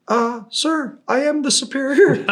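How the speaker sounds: high, timid admission; meek paratrooper owning up he's in charge; sheepish young soldier. Retold or imitated. Paratrooper tells the team sergeant he is the superior, high and sheepish.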